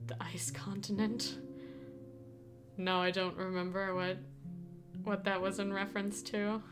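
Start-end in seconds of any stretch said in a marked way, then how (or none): none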